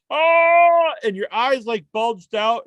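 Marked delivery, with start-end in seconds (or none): 0.1-0.9 high, loud gagging cry; mock choking scream. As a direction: wail as if hosed in the mouth. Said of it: "you're going".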